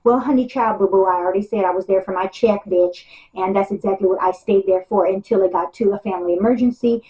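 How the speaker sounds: ordinary talk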